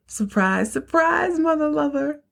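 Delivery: high, sing-song taunting surprise; spring the surprise with a smug high lilt; gleeful surprise. Retold or imitated. Abby shows up at door.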